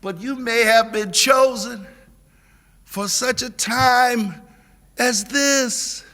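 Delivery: rally the room with a rising shout; preaching at full force. Acted. Pastor urging the congregation to build bridges, full preaching cry.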